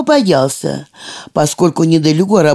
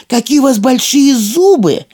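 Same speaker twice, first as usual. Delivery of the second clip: amazed; 8 semitones higher than usual. A narrator's reading. Girl amazed at grandma.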